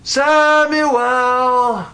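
high, drawn-out summons; calling out. Acted. Voice calls the boy a third time, high drawn-out call. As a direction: sing the name out long and high. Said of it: "then he hears again".

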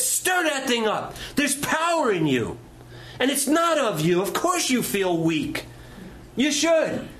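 high, forceful apostle's charge; urgent exhortation. Retold or imitated. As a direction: rouse the listener to act. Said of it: "He said".

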